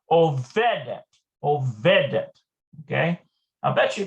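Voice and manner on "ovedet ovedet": Ovedet said twice, louder, weight on the -ve-; stressed vav. Shown as a demonstration. Teacher showing where the stress falls, hitting -ve- in Ovedet. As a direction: press the middle of Ovedet. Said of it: "it's the emphasis".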